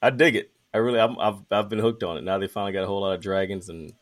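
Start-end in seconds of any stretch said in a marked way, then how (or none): none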